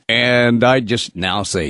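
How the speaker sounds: usual speaking voice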